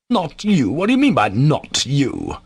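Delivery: high-pitched, astonished questioning; thunderstruck. A narrator's reading. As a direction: reel in disbelief, pitch swooping. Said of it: "Ivan was thunderstruck".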